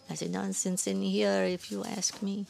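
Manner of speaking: put-on old woman's teasing French accent; coy old woman with a French accent. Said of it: "heavy French accent".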